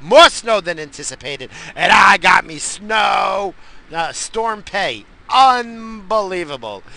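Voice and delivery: loud, high, drawn-out crowing; elated bragging. Real, not acted. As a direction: stretch it out loud, thrilled by the windfall. Gloating over snow and storm pay.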